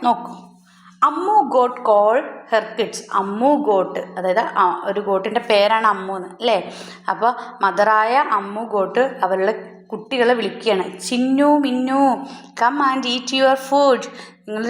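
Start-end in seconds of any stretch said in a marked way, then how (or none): 1.0-3.0 put-on slow, deliberate read-aloud for children; slow story reading. A narrator's reading. Teacher reading a story to her pupils, slow and clear. 11.0-14.1 a hint of a mother calling her kids, slow; mother goat calling. Mother goat calls her kids to come and eat, slightly higher.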